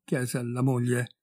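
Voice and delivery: ordinary delivery, nothing marked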